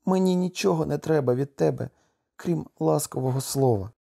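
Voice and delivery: emotional narrated outburst; exclaiming. A narrator's reading. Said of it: "вигукнула вона".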